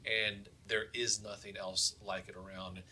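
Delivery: ordinary talk, nothing marked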